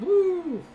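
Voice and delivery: loud, high-pitched whoop; cheering whoop held high; high woo. Real, not acted. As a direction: let out a long high "woo". Mock cheer at thirty-second place.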